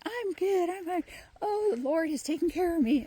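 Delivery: high, sing-song fake cheer; mock chirpy denial. Mocking someone gaslighting themselves, chirpy fake cheer. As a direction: parody the person insisting all is fine. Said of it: "when you gaslight yourself".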